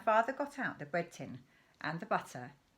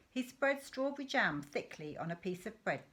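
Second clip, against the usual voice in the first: usual speaking voice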